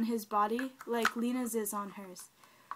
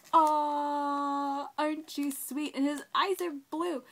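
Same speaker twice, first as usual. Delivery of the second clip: loud, higher adoring coo; gushing coo; 3 semitones higher, 8 dB louder, slower than usual. Real, not acted. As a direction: coo loudly over something adorable. Cooing over the new doll.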